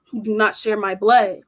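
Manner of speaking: ordinary talk, nothing marked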